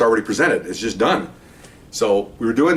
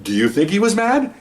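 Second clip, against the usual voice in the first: incredulous echo; 9 semitones higher than usual. Retold or imitated. Vice cop answering his partner's question, incredulous echo. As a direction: pitch climbing high to a questioning MAD.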